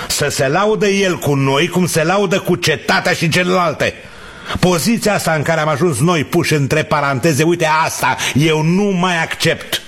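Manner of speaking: high-pitched, worked-up tirade; indignant protest. Acted. Father refuses to let the mayor use his child, indignant.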